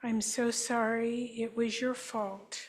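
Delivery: guided healing affirmation. Acted. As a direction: offer each apology gently, like a therapist. Leads group in healing statements.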